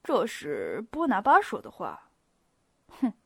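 measured, coldly scornful remark with a snort; cold sneer. A narrator's reading. Prince Andrei answering with a cold laugh, scornful. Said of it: "安德烈公爵冷冷一笑".

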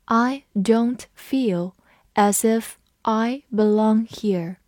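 slow, careful reading of the sentence. Shown as a demonstration. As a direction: read it slowly, word by word. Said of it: "我们慢慢读一遍".

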